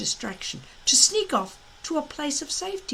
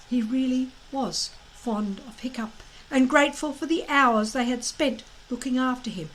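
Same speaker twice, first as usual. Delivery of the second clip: ordinary delivery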